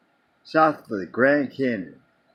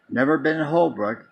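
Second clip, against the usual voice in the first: ordinary talk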